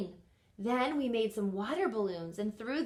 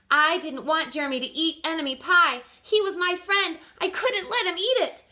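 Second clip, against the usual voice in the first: rising panic; 6 semitones higher, 7 dB louder than usual. Acted. Narrator panicking.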